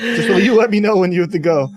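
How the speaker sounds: high, loud urgent ask; exasperated plea. Real, not acted. Guest pleads with host who needs a bathroom break, urgent. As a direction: beg him to wrap it up.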